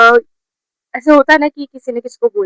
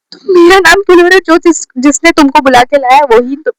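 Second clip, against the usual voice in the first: higher, louder retold objection; indignant protest; 4 semitones higher, 4 dB louder than usual. Accused student challenges classmates who named her, indignant. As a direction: push back fast, then ease off.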